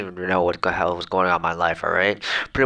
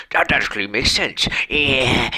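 higher-pitched exaggerated agreement; mock amazement; 5 semitones higher than usual. Reacting to a viewer's comment, mock amazed. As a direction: overplay being impressed.

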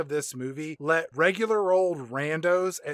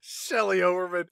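usual speaking voice

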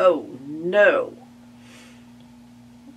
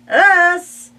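very high, loud astonished question; 13 semitones higher, 13 dB louder than usual. Acted. Willie astonished at moving into the barn, high loud. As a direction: blurt it in disbelief.

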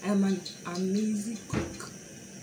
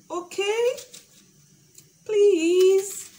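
high-pitched playful begging; high pleading; 7 semitones higher, 5 dB louder, slower than usual. Pretend. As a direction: plead with them, high and loud. Begging viewers to subscribe.

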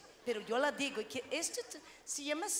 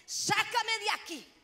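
angry God; 4 semitones higher than usual. Retold or imitated. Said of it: "el Señor le dice".

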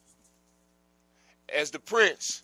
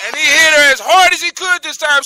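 shouting the blow out; loud; 11 semitones higher, 20 dB louder than usual. A narrator's reading. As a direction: build to a full shout. Climax of the fight.

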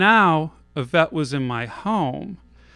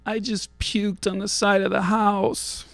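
high, overacted sick announcement; melodramatic; 7 semitones higher than usual. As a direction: ham it up like a tragic confession. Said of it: "melodramatically announced".

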